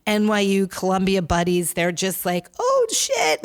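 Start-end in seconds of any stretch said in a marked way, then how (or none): 2.6-3.4 high, yelped oh shit; surprised oh shit. Retold or imitated. Host voicing frat boys confronting Trump supporters, startled yelp. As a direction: jump up in pitch, caught off guard.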